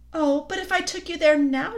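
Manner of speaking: lightly voiced queen, coaxing and sweet; a hint of a sly, honeyed queen; queen coaxing the boy gently. A narrator's reading. Queen speaks.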